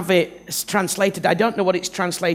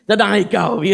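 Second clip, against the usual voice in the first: noncommittal Moses; 7 semitones higher, 5 dB louder than usual. Acted. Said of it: "He's like".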